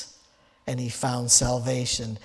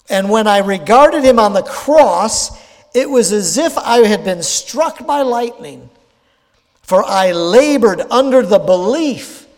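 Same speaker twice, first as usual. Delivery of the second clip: fervent preached quote; 5 semitones higher, 7 dB louder than usual. Retold or imitated. Preacher quoting Luther's confession to the congregation, fervent and loud. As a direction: read it out loud and high with rising fervour, like a confession. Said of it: "He said this".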